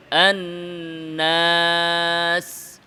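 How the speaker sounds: আন্নাস with the নুন nasal and the alif clear; nasal nun only. Shown as a demonstration. Teacher modelling nasal nun for students. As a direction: hum the নুন of আন্নাস, keep the alif oral. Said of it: "নুন নাকে".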